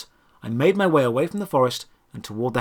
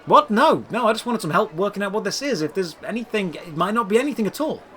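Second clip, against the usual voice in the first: higher, fast hurried protest; hastily backpedalling; flustered denial; 6 semitones higher than usual. A narrator's reading. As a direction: rush to smooth it over. Hero hastily denies insult.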